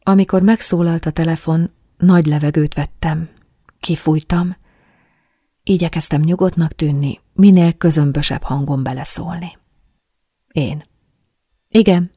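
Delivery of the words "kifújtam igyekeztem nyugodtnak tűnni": plain reading voice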